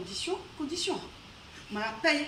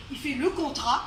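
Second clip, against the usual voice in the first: usual speaking voice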